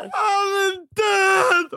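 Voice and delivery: high, slow sobbing wail of grief; hammed-up crying shout. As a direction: wail it out as if heartbroken. Sobbing over dead singer.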